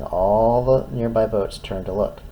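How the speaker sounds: plain reading voice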